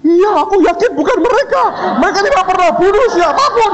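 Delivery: very high, squealing comic insistence; mock frantic defence. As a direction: vouch for the students in hammed-up panic. Lecturer frantically defends students.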